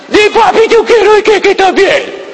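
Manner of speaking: put-on very high little-kid plea; very high childish whine; pleading child. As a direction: beg papi like a small child. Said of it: "qué es lo que dice el niño".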